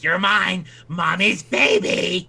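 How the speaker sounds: high-pitched, gloating villainess; possessive monster mother. Acted. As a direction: claim your child like a creepy monster mother. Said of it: "She's like".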